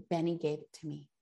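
a hint of a little girl crying; tearful daughter. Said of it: "she starts to cry".

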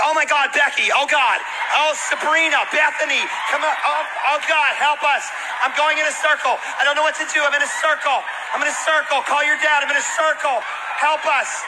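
hammed-up frantic girls calling for help; scared girls spinning in a circle, hammed-up panic; mock panic.

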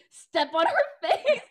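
loud, high, slow, laughing yell; mock yell. Friend imitating her own yelling at Beth. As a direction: holler it half laughing. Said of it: "me just yelling".

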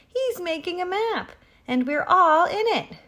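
high-pitched excited group cry; excited shout. A narrator's reading. Animals tell the horse about the map, shouting. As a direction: shout it high, rising in volume. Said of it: "quickly shouted".

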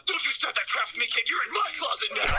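a hammed-up priest who finally snaps; angry priest. Priest in the confessional scolding Timmy, high mock anger.

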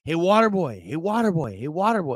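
loud, high taunting chant; mock a bratty fan heckling; heckling kid. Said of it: "some kid going".

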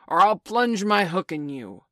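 loud pirate captain's threat; menacing, full-voiced shout; threatening cry. A narrator's reading. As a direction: bellow the threat across the water. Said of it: "cried Peter".

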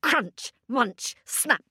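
ordinary delivery

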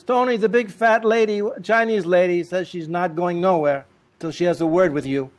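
high, loud insistent report; insistent wife. Retold or imitated. Wife insisting the visitor won't leave, loud and insistent. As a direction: push the message through.

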